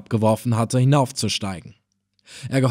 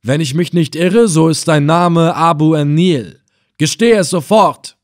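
raised, demanding interrogation voice; stern commander; 5 semitones higher, 6 dB louder than usual. A narrator's reading. Reis Effendina interrogating the helmsman, stern and loud. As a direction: press him for a confession.

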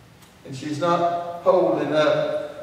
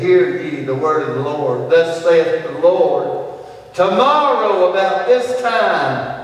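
prophetic proclamation; 4 dB louder than usual. Acted. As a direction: declaim it like an Old Testament prophet.

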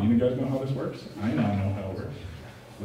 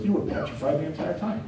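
the Lord scolding; 7 semitones higher than usual. Retold or imitated. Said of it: "when the Lord's like".

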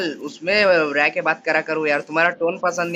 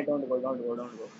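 "don't go" dropped low and much softer, trailing off; lower, muted "don't go"; fading plea; 8 semitones lower, 12 dB quieter, much slower than usual. Real, not acted. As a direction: mumble "don't go" to yourself, giving up. Begging fades to mumble.

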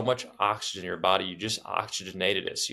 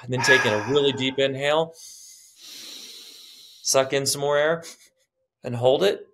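urgent coaching; 3 semitones higher than usual. Real, not acted. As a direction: push them to the last breath. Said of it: "coaching through breath hold".

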